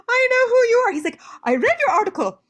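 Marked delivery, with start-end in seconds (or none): 0.1-0.9 much higher and louder, gushing; retold starstruck gush at a famous scholar. Student gushing to the famous editor, much higher and louder. 1.4-2.4 lightly voiced German man, much higher; a hint of a kindly German scholar praising her; sweet German man. German editor praising the student's article, lightly voiced and much higher. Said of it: "very sweet German man".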